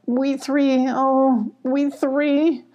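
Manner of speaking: high plaintive lament; acted mild weepy moan; starting to cry. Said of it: "started to cry".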